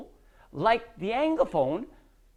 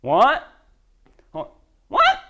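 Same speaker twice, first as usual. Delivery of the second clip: loud, startled-sounding "what?" shooting up high; blunt reflex what; 4 semitones higher, 9 dB louder, slower than usual. Shown as a demonstration. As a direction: blurt it out as a rude reflex question. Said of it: "That is a poor reflex".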